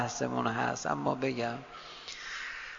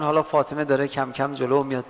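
normal talking voice

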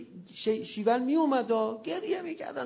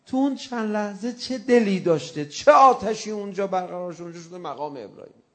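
usual speaking voice

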